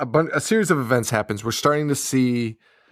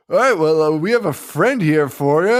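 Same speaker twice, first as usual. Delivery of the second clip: imitated TV host; 4 semitones higher, 4 dB louder than usual. Retold TV host announcing surprise guest.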